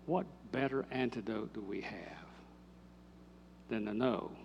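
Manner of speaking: hushed breathy question to the congregation; preacher's soft whisper. Real, not acted. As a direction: lean in and whisper it.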